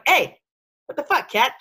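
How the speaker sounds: hammed-up sharp reprimand; mock scolding. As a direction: play-act putting the cat in its place. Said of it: "there's an attitude adjustment".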